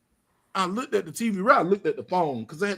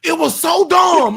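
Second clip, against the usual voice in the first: loud, high, slow outburst; fed-up yell; exasperated shout; 13 semitones higher, 9 dB louder, slower than usual. Real, not acted. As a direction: belt out so dumb. Exasperated rant.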